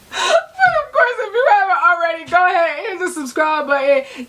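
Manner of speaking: high, laughing infomercial lady; hammed-up infomercial pitch, laughing. Shown as a demonstration. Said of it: "sound like an infomercial lady".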